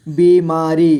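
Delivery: बीमारी split into बी, मा, री; syllable by syllable. Shown as a demonstration. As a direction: draw out each syllable of बीमारी. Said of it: "थोड़ा fast पढ़ेंगे". (बीमारी को तोड़कर: बी, मा, री)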